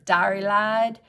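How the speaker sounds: "Dari Lide" drawled in a playful Southern accent; lightly put-on Southern accent on "Dari Lide". Shown as a demonstration. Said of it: "when you say it with a Southern accent, it sounds so much better".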